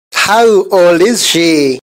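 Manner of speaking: slow, careful English sentence; slow model reading. Shown as a demonstration. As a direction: say each word slowly and clearly. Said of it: "teacher says sentence slowly".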